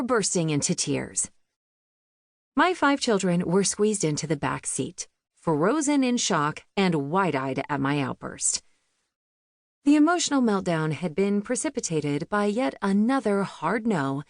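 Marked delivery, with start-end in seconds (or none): none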